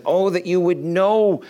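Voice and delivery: high, drawn-out cry of lament, easing off; impassioned lament. Acted. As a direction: lament out over the city as a preacher voicing Jesus. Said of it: "He lamented".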